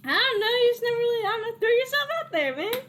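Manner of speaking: high-pitched, amazed uncle voice; high, disbelieving uncle; flustered uncle. Acted. Uncle explains his surprise to nephew.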